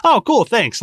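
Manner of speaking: high, sarcastic brush-off. Retold or imitated. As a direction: say it with mock brightness. Mocking manager's reply.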